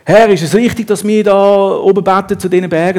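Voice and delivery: woman pressing Jesus with theology question; eager questioning woman. Acted. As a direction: ask urgently, seeking an answer. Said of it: "Sie fängt plötzlich an".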